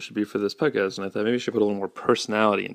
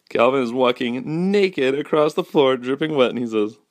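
ordinary talk, nothing marked

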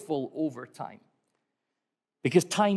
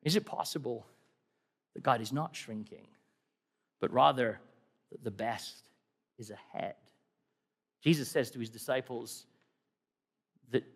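hushed; 6 semitones lower, 6 dB quieter than usual. Real, not acted. Quiet climax of the sermon.

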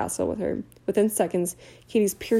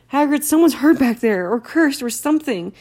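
higher and louder, urgent; urgent shout; 6 semitones higher, 7 dB louder than usual. Acted. Harry to Hagrid over the wind, an urgent shout. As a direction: shout the alarm over the storm.

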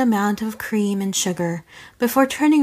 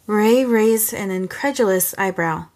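usual speaking voice

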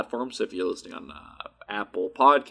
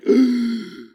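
loud gasp of recognition; sharp gasp; 11 semitones higher, 5 dB louder than usual. Retold or imitated. Retelling the moment it clicked, gasping. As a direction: gasp as the memory hits. Realization retold.

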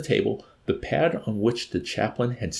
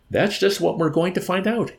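high-pitched, emphatic retort; answering back; 10 semitones higher, 4 dB louder than usual. A narrator's reading. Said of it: "answered the colonel".

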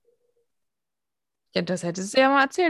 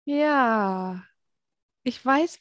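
usual speaking voice

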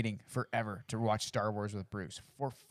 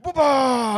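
monster sound effect; 13 semitones higher, 12 dB louder than usual. Retold or imitated. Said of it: "I do the sound effects".

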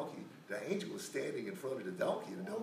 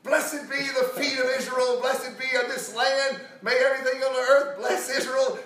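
high, loud hammed-up blessing; booming proclamation; 12 semitones higher, 11 dB louder than usual. Prophet told to curse Israel, shouting a blessing instead.

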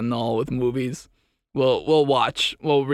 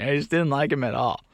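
ordinary talk, nothing marked

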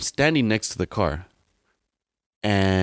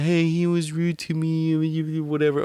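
high, slow mock whine; high drawn-out mock complaint; mocking whiny customer; 6 semitones higher, slower than usual. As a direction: imitate the lady complaining, dragged out. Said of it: "She's like".

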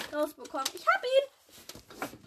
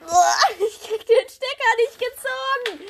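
strained exclamation; 8 semitones higher, 7 dB louder than usual. Real, not acted. Struggling with a stuck plug.

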